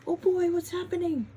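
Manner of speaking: high startled gasp into exclamation; gasp, then ask in alarm; surprised gasp. Acted. Excited gasp at story turn.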